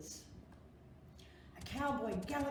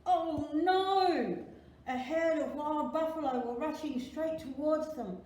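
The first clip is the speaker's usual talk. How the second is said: higher, louder alarmed telling; narrator alarm; 5 semitones higher, 6 dB louder than usual. A narrator's reading. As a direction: sound the danger. Storyteller alarm.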